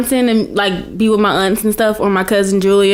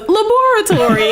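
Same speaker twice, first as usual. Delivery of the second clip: high British LABORATORY, "la-BOR-a-tory"; exaggerated British "laboratory", stressed on BOR, much higher; imitated British aunt; 11 semitones higher than usual. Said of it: "And my aunt goes".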